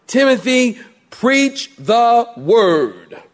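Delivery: slow, weighty proclamation; preaching. Acted. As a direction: drag out preach the word with gravity.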